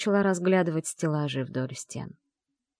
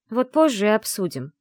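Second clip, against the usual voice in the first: louder, cutting it short; curt retort; 6 semitones higher, 5 dB louder than usual. Acted. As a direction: shut the talk down. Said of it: "отрезала я". (резко отрезать)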